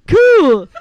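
high, very loud southern exclamation; southern accent. Acted. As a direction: belt it out like an awed southerner. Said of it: "southerner accent like".

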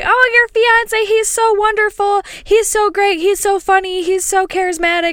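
very high, drawn-out mock gushing; exaggerated high-pitched adoration; gushing customer. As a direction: imitate a starstruck fan piling on praise. Mimics gushing restaurant customers.

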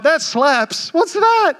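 high, baffled mock question; puzzled question. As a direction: play the confused older guy. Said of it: "but I'm like".

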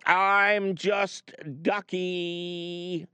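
slow playful drawn-out reply; drawn-out jokey. Acted. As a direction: stretch it out, joking. Joking reply to caller.

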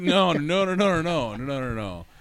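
rapid high loud string of no; high loud rushed noes fading down; frantic no. Acted. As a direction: wave off the idea in a panic. Frantic refusal to leave of absence.

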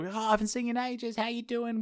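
high, light sisterly greeting; high-pitched acted fussing; gushing sister. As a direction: gush over a long-missed brother. Sister greets brother with cuddle.

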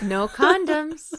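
high and slow mocking echo. Real, not acted. Mock echo with laugh.

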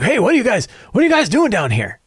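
high, startled mad scientist; Doc Brown. Retold or imitated. Villain greeting the adventurers as Doc Brown, high and surprised. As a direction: act caught off guard, pitched high. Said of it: "I based him on Doc Brown".

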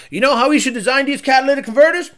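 lightly voiced Ford engineer, fast and eager; hammed-up boardroom question; mock Ford engineer. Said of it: "no stress test at Ford that says".

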